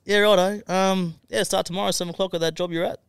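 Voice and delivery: high, quick and cheerful; chirpy boss voice. Retold or imitated. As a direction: toss it off brightly. New boss voiced on phone.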